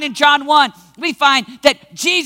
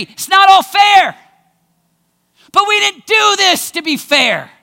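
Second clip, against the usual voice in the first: emphatic; 5 semitones higher, 4 dB louder than usual. Real, not acted. Climactic point.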